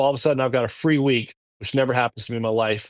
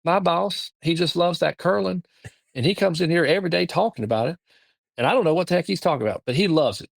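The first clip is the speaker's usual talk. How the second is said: a lightly voiced North Georgia Southern accent, pitched higher and eager; imitating the assistant's sweet Southern phone manner, higher; thick Southern accent; 4 semitones higher than usual. Assistant Grace asking the ticket office for the boss, in a mild Southern accent. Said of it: "she's got a thicker Southern accent".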